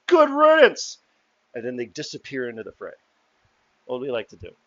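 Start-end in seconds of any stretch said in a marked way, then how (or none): 0.1-1.0 high, loud parting shout; jeering heckler. Acted. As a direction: shout it after someone like a crowd heckler. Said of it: "And the heckler goes".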